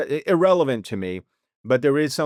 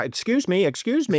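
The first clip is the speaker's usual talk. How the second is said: reporter voice; 6 semitones higher than usual. Retold or imitated. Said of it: "putting on his reporter voice".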